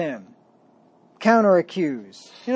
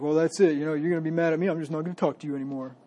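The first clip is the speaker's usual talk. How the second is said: hammed-up pouting, fast at the end; mock a petulant, offended sulk; sulky brush-off; much faster than usual. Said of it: "he's like".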